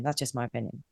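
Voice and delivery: a murmured afterthought; quiet echo. Real, not acted. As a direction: drop the voice right down and repeat it. Repeats her closing remark.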